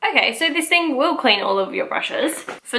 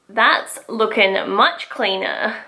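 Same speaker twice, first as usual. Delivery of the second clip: normal talking voice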